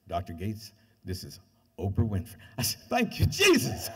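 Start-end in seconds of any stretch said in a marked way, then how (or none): 0.1-2.4 a woman's voice done lower, calm and measured; deep-voiced Oprah. Oprah introducing herself on the phone, lightly imitated low. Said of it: "a deep woman's voice said". 2.9-3.9 higher and louder, slow, overjoyed; joyful outburst. Retold or imitated. Thrilled when Oprah calls him back, loud joyful thanks.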